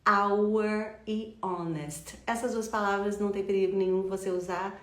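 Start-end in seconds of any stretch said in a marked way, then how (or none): 0.0-2.2 "hour e honest" very slowly, no H; drawn-out model of "hour" and "honest" without breath; silent H. Shown as a demonstration. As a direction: stretch "hour" and "honest", each begun on the vowel. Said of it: "sem H pronunciado".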